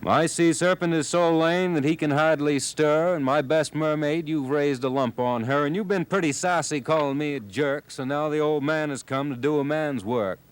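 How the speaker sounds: high, loud fuming sea king; a hint of a furious old man of the sea; mad old man. A narrator's reading. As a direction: scold him, fuming. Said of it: "madder than a hornet".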